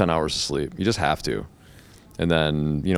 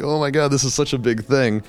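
higher-pitched mock nerves; acted nervousness; 7 semitones higher than usual. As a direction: imitate getting worked up before going on. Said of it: "super fucking nervous".

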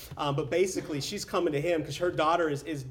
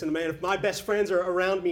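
ordinary talk, nothing marked

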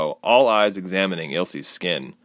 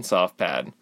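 plain reading voice

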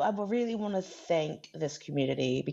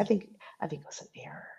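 fast hushed whisper; whispering a conspiratorial excuse about the ban; 12 dB quieter, much faster than usual. Acted. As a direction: whisper it like a secret. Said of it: "whispered aside about the ban".

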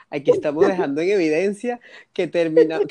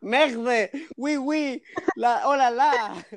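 mock French; 7 semitones higher, much slower than usual. Mocking Frenchman's reaction.

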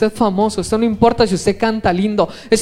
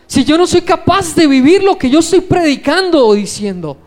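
preaching with lifted pitch, pressing each question; fervent preaching; 4 semitones higher than usual. Acted. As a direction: plead with the congregation like a pastor in full flow. Preacher opening with raised voice.

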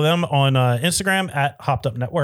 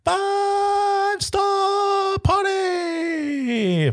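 shout; much slower than usual. Pretend. Hosts ask for ratings, shouted.

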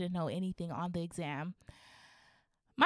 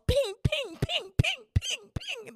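very high, squeaky pinging; cartoonish imitation of bouncing; bouncing sound effect; slower than usual. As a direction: ricochet each "ping" up high. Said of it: "The cat is bouncing on the walls".

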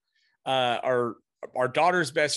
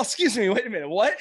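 retold disbelief; 7 semitones higher than usual. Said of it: "I'm all".

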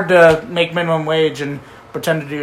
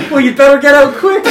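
laughing quote; 8 semitones higher, 7 dB louder than usual. Retold or imitated. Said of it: "Jesse goes".

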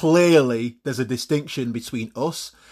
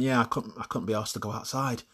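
blasé; 7 dB quieter than usual. Acted. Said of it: "completely blasé and he went".

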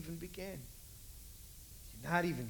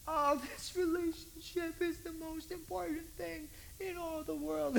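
higher mock whining voice; whiny believer; 9 semitones higher than usual. Believer complains to Jesus, mock whining. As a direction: whine like a lovesick teen.

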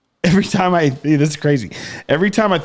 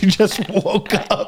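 normal talking voice

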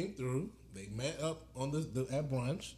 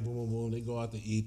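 ordinary talk, nothing marked

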